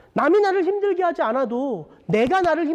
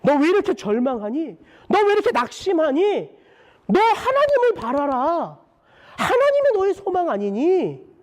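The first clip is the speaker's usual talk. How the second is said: insistent self-exhortation, climbing higher with each question; crying out to oneself; 3 semitones higher than usual. Acted. Preacher crying out to his own despairing soul, building high exhortation. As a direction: challenge your own soul, building in pitch. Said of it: "끊임없이 어떤 때는 외쳐야 되는구나".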